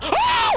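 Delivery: sharp high gasp of shock; startled gasp. Real, not acted. Gamer sees an enemy teleport, sharp high gasp. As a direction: suck in air in surprise.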